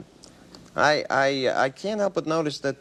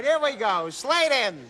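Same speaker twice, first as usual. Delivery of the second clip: ordinary talk, nothing marked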